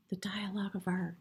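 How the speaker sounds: "the dialogue of art" whispered softly, with no voice. Real, not acted. Reader naming the tarot card to the viewer, in a reverent whisper. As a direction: hush the card's name reverently.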